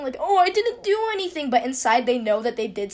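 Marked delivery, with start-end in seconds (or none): 0.2-1.5 high-pitched, put-on innocent whine; fake crying. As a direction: act out obviously fake tears. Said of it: "when people cry, like, fakely".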